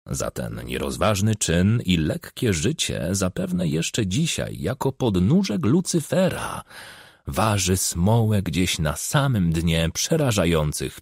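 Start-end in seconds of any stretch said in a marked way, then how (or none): none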